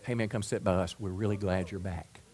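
soft, low, reassuring invitation; acted gentle welcome. Church member welcoming someone just out of jail, soft and low. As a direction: take them in quietly, voice dropped.

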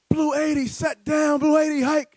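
loud, high quarterback bark of the count; shouted cadence. Retold or imitated. Quarterback calling the snap before the big run, shouted. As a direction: belt the snap count across the line.

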